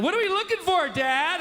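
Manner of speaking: high, lightly voiced kid asking; a hint of a whiny child; imitating his son. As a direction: play the bored little boy nagging. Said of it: "who every five minutes is like".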